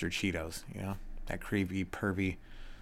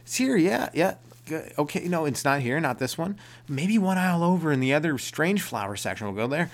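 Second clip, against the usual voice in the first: much higher, overconfident clerk; high squeaky clerk impression; 8 semitones higher, 4 dB louder than usual. Clerk insisting to the customer, much higher and squeaky. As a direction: play the clerk piping up, sure it's there. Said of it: "He was like".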